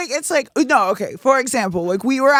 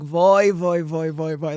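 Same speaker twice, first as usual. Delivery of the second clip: Persian exclamation; 3 semitones lower, slower than usual. Retold or imitated.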